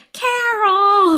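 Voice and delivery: high, loud weaselly call; Charlie Kelly. Retold or imitated. Cohost imitating Charlie at Carol's door, weaselly yell. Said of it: "It's kind of high-pitched".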